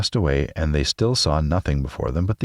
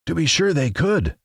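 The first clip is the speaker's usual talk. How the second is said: much higher, eager scarecrow cry; excited cry; 11 semitones higher than usual. A narrator's reading. Scarecrow reacting to Dorothy's plan, eager higher cry. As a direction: exclaim it, delighted by the idea. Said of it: "cried the Scarecrow".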